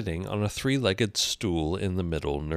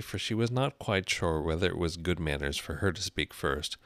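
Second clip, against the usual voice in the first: usual speaking voice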